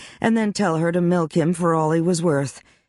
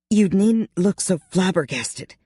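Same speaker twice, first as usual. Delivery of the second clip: usual speaking voice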